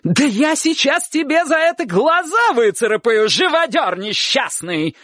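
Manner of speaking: high scolding threat, slowing on the insult; furious cry. A narrator's reading. Aunt threatening the dog-catcher, shrieking angrily. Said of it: "вскрикнула тётушка".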